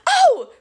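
high, loud startled yelp. A narrator's reading. Grover nearly exposed as satyr, high yelp. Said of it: "Grover yelped".

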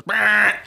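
imitated truck lane warning buzzer; buzzing alarm. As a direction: mimic the lane departure alarm. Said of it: "It just goes".

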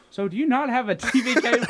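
mock quote voice. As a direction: ham up the friend's sly question. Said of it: "He looks at me".

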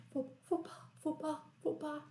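soft, put-on little old lady voice; old lady's voice. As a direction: imitate the old lady saying fopá. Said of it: "ela fala com a voz bem assim". (imitando a voz da senhorinha, baixinho)

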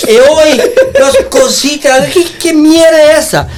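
high, slow indignant broken Spanish; hammed-up Spanish-accented protest; indignant mock Spanish. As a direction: complain to the waiter in fake Spanish, outraged.

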